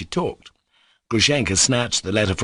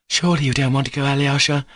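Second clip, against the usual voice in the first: mournful surprise; 7 semitones higher, 3 dB louder, faster than usual. A narrator's reading. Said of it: "she cried in mournful surprise".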